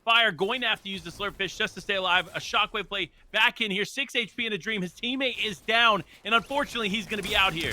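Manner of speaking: fast, loud, high hype call; esports caster. Acted. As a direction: call the fight at full hype. Hyped play-by-play of final fight.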